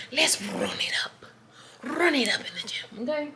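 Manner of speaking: whispered hype chant, slowed; hyped whisper. Shown as a demonstration. Pumping herself up for the gym in a whisper.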